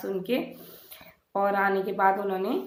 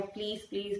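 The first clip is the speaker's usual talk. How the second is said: soft, drained please, please; quiet pleading; 10 dB quieter than usual. Retold or imitated. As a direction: beg almost under the breath. Begging husband for an operation. (धीमी आवाज़ में गिड़गिड़ाना)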